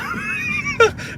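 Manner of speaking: high-pitched burst of laughter. Real, not acted. Laughing at own dad impression, high giggle. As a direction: crack up in a high squeal.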